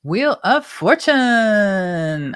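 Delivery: slow, drawn-out big announcer call; game show host. Pretend. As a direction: present it like a TV quiz host. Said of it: "I sound like a game show host".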